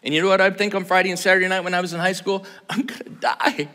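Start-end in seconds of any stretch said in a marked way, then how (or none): 2.7-3.7 whispered dread. Retold or imitated. Teen dreading dad's prayer.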